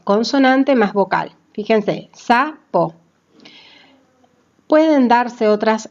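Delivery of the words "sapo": "sapo" split as sa, po; syllable by syllable. Shown as a demonstration. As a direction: pause between the syllables of "sapo": sa-po. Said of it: "predominan las sílabas".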